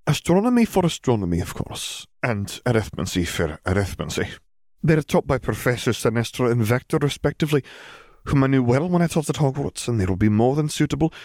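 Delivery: businesslike teacher voice; walk her through it; brisk McGonagall. Acted. Headmistress explaining subjects to Hermione, brisk.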